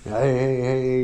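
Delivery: lightly voiced friendly passersby greeting; casual greeting imitation. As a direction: toss off a breezy hey, hey, hey like strangers walking in. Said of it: "It's, it's walking".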